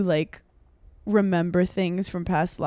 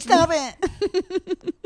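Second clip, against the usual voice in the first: laughing mimic; 9 semitones higher than usual. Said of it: "I'd be like".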